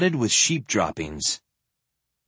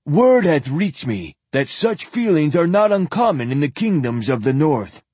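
put-on higher, louder old priest voice; lightly hammed-up high zealot; 5 semitones higher, 3 dB louder than usual.

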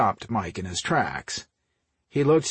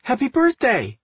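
high, loud birthday greeting; grinning birthday cheer; 7 dB louder than usual. A narrator's reading. Sister bursts in greeting her brother, grinning. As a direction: call it out with a big grin. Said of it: "Ruth grinned".

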